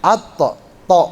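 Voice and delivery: At, to with a bouncing stop; qalqalah bounce. Shown as a demonstration. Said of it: "dia qalqalah". (at, to dengan pantulan qalqalah)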